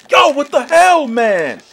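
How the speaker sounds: high, loud protest, falling at the end; angry yell. Real, not acted. Gamer protesting what the game did, angry high yell. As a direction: yell it in exasperation.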